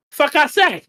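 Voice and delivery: normal talking voice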